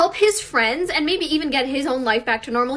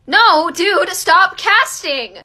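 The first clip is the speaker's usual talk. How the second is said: louder, higher, drawn-out plea to stop; exasperated scolding; 5 semitones higher, 6 dB louder, much slower than usual. Real, not acted. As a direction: scold him like a friend messing up. Scolding the film character.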